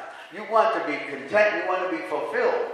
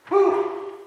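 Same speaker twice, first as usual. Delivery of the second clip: relieved whew; 12 semitones higher than usual. Real, not acted. Exhale after a prayer.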